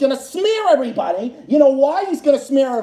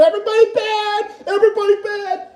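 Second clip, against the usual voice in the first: mocking sing-song; 8 semitones higher than usual. Mocking his critics' line.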